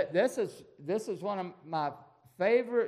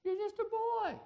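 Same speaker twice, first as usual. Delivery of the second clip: put-on a king scoffing at a boy, pitched way up; Saul doubting. Saul telling David he's just a boy, high incredulous voice. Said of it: "He said".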